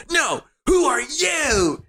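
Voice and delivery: very high, drawn-out mock wail; mock retort. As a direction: throw it back in an exaggerated high squeal. Adam throws the question back.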